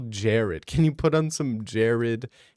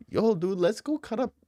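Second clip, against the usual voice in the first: higher, excited buddy voice throughout; a higher, hammed-up Mexican dude impression; mock Mexican bro; 9 semitones higher than usual. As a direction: hype a friend up to go get tacos. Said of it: "You're hearing them talk like".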